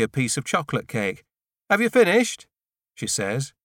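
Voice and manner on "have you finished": high, lighter female voice for Angelica; a higher, louder character voice for Angelica; Angelica's voice. A narrator's reading. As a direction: lift into her voice, asking gently. Narrator voices Angelica's question.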